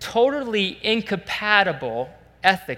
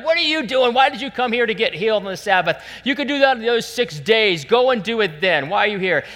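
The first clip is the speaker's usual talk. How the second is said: indignant, louder telling-off; acted scolding; 5 semitones higher, 4 dB louder than usual. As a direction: play an official scolding people for turning up. Synagogue ruler scolding, acted out.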